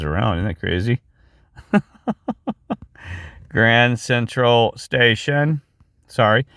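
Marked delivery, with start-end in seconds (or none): 3.5-5.6 Grand Central Station drawn out slowly, word by word; slow, deliberate enunciation; slow spelling-out. Shown as a demonstration. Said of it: "Just for people that don't get it".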